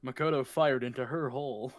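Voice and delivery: normal talking voice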